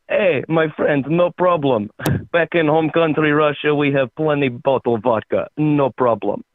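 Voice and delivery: put-on easygoing comic Russian accent. Russian guy reassures his French friend, Russian accent. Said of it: "That's a good Russian".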